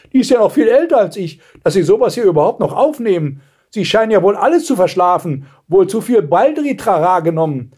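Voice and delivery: high-pitched grumbling disbelief; exasperated grandmother. A narrator's reading. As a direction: carp like an appalled grandmother. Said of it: "stöhnte die Großmutter". (entrüstete Großmutter, hohe Stimme)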